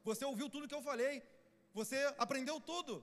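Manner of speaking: high, emphatic acted scolding; hurt, insistent telling-off in a preacher's voice; Jesus rebuking Cleopas.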